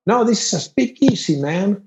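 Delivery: high-pitched imitation of a local; voiced party host.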